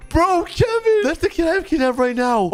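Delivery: very high-pitched exclamation; squealed disbelief, easing slightly; shocked realization. Real, not acted. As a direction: blurt it out, astonished. Shocked the kid knows Kevin.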